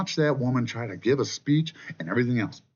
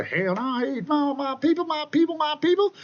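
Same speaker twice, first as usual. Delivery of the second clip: Kamala Harris. Retold or imitated. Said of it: "she changes her fucking accent".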